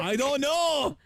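high Cork lilt; Cork accent. Pretend. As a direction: answer in Cork voice. Co-host joins Cork voice.

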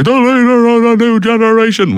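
hammed-up wrestling commentator impression; Vince McMahon. Host imitates a nineties wrestling commentator, big high fake voice. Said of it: "with a big kind of fake voice".

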